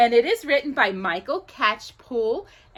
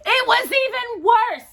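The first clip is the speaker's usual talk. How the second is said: exaggerated rise; 12 semitones higher than usual. A narrator's reading. Exaggerated reading.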